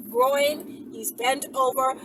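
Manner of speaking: ordinary delivery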